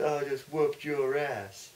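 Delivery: slow, drawn-out, lightly voiced tough guy; a hint of a Texan brawler gloating; mild Stone Cold swagger; Stone Cold quote. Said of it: "Austin three sixteen said".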